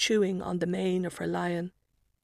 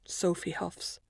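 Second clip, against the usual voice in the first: ordinary delivery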